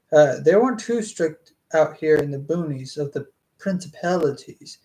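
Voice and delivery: ordinary talk, nothing marked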